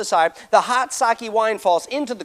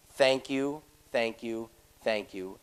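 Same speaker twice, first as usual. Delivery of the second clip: softer and lower with each thank you; thanks trailing off in disbelief; a touch of awe, dropping quieter; fading grateful thanks; 8 semitones lower, 7 dB quieter, much slower than usual. Retold or imitated. Overwhelmed thanks for paid tab.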